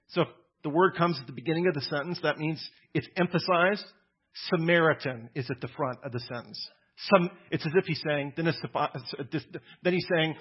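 normal talking voice

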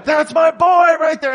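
very high, loud sideline holler; dad screaming. Retold or imitated. Proud dad to the other parents, screaming. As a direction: scream with a father's pride at the game. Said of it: "and screaming".

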